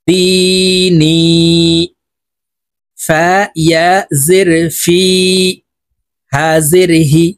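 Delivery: slow, drawn-out letter-by-letter recitation; Qaida spelling drill. Shown as a demonstration. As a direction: stretch the long vowels on a level tone. (ধীরে টেনে টেনে কায়দার বানান পাঠ)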